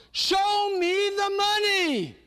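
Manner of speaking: yelling. Acted. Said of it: "he's trying to say".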